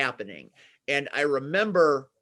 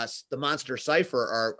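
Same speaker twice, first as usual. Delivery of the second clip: normal talking voice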